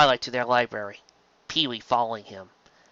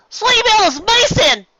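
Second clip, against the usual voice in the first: sobbed; 9 dB louder than usual. A narrator's reading. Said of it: "Apple Bloom sobbed".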